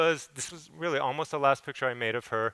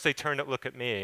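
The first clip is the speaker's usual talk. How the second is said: ordinary talk, nothing marked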